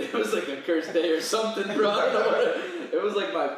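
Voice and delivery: laughing through the words; can't stop laughing as he tells it; giggling all through. Real, not acted. Laughing while telling story.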